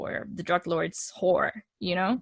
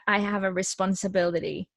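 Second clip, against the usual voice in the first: ordinary talk, nothing marked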